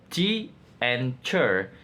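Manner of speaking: TEACHER split into two slow syllables; syllable breakdown. Shown as a demonstration. Student presenting the syllable breakdown of teacher, slow and separated. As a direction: say "T and chair" slowly, each syllable apart. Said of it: "syllable breakdown is two".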